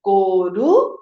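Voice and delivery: "Coruja" as held "coru", rising, a pause, then "ja"; joining syllables. Shown as a demonstration. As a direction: model "Coruja" slowly, two syllables joined and "ja" apart. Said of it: "vamos ler inteira agora".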